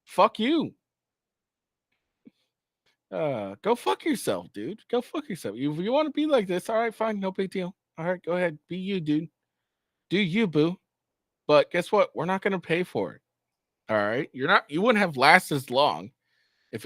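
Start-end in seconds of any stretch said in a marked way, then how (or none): 0.0-4.8 angry rant. Acted. Streamer yelling at Zelensky in contempt. 4.9-13.2 high, mocking brush-off; sarcastic dismissal. Streamer sneering at Zelensky, high and mocking.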